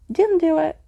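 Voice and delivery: very high, loud, strange cry; ghost girl's cry. Acted. Hidden ghost girl crying out from the cupboard. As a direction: shriek it from a hiding place.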